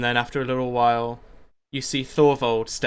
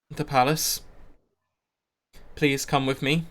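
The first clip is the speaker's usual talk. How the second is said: measured, slightly raised public address, broken by pauses; leader addressing townsfolk; 3 semitones higher, 3 dB louder than usual. Acted. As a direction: project gently to a crowd, phrase by phrase. Caster gives instructions to the crowd.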